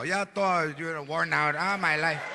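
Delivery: put-on tired old grandma. Imitating worn-out old grandmother.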